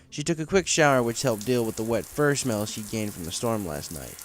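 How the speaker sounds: plain reading voice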